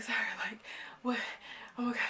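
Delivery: breathless whispered disbelief; imitated shocked, airless gasping; breathing hard. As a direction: whisper it between heavy breaths. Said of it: "Like, just breathing hard".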